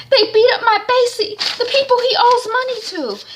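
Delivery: very high, loud wailing cry; wailing wife. Retold or imitated. Tasha bursting in about Basie beaten, loud wail.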